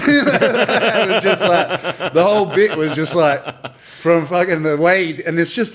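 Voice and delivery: high, loud laugh through the words; cracking up recalling the bit; laughing hard. Real, not acted. Telling friend about the roast bit, loud laughing. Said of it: "laughing while telling".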